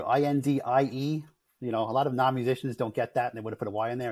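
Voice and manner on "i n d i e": I N D I E spelled letter by letter, slow; spelling out slowly. Shown as a demonstration. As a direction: spell I N D I E out clearly. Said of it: "Indie Band Guru".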